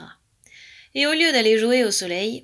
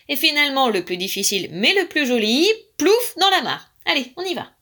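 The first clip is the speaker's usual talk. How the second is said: high, louder, energetic instructor; frog dance teacher; 5 semitones higher, 4 dB louder than usual. A narrator's reading. Frog showing Alaric the jumps, brisk instruction. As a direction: lift each step like a jump.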